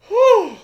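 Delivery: a big high sigh of relief, loud; high loud relieved whew. Retold or imitated. Friend recalling the cell opening, lets out a loud high whew. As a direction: blow out relief like the door just opened.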